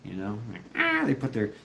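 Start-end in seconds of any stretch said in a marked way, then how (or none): 0.8-1.0 high, drawn-out imitated honk; goose honk. Imitating a nesting goose coming at you, high honk. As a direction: mimic a charging goose's cry. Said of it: "they start honking".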